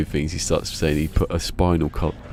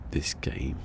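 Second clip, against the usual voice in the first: soft whispered grumble; whispered complaint at the game; 3 semitones lower, 10 dB quieter than usual. Real, not acted. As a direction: breathe it out, fed up with the game. Muttering at the game alone.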